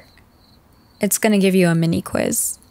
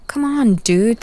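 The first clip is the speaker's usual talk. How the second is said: usual speaking voice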